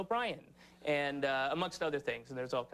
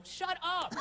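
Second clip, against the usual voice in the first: exasperated dismissal; 14 semitones higher than usual. Retold or imitated. Irritated at hippies.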